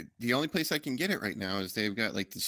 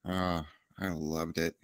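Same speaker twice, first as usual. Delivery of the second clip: usual speaking voice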